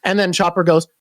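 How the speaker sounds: ordinary talk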